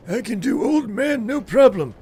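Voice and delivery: put-on high-pitched, loud old-man voice. Shown as a demonstration. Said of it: "it was Old Man British Strife".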